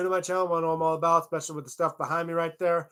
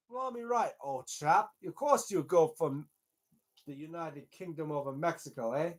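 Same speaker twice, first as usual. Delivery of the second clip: hammed-up posh English voice; playful fake British accent; mock British accent. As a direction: play a cartoon Brit cheering on the UK. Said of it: "that's a weird accent".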